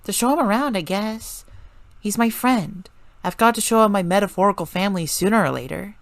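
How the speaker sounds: lightly voiced casual girl; breezy young woman. A narrator's reading. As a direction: explain it offhandedly. Melissa voiced higher, casual.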